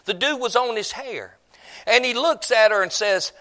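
ordinary talk, nothing marked

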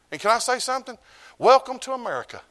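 high, loud preacher's cry; fiery preaching. Acted. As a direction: throw it out to the congregation. Preacher rises to full force.

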